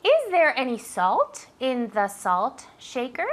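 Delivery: slow, careful English question for a learner; slow teacher question. Shown as a demonstration. Teacher asking Ángeles a slow drill question. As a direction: spell the question out slowly.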